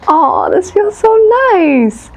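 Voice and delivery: high, slow, melting sigh of comfort; blissful relief. Retold or imitated. Listener dropping the sword with a new love, melting. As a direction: melt into it like finally safe. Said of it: "you'll be like".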